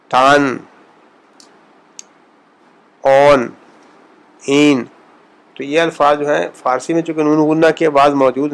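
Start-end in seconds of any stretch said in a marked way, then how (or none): 0.1-4.8 each word ending with a full open n; clear Persian n. Shown as a demonstration. Teacher demonstrating Persian noon. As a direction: sound the n in اون fully. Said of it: "جیسے ہم شیرین".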